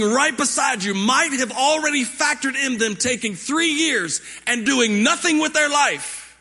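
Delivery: high, driving sermon delivery; impassioned preaching. Acted. Pastor urging congregation about God's calling, impassioned preaching. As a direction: preach it with rising force.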